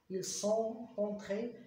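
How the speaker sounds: each form spelled out very slowly; slow teacher dictation of the endings; slow conjugation drill. Shown as a demonstration. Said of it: "E accent E S".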